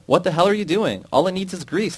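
high, loud angry shout. A narrator's reading. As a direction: yell at him in outrage. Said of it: "the foreman shouted".

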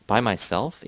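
ordinary delivery, nothing marked

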